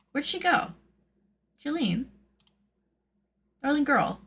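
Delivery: high, sing-song calling for a pet; cooing pet call. Pretend. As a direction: coo sweetly as if searching for a little pet. Calls to her missing mascot.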